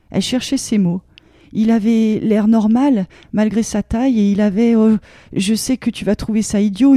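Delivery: plain reading voice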